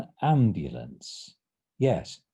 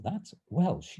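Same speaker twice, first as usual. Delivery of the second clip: usual speaking voice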